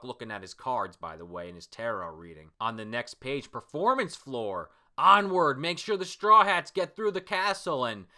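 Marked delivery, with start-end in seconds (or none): 5.0-7.8 loud, high battle command; hammed-up warrior's shout; rallying cry. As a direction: urge the troops onward.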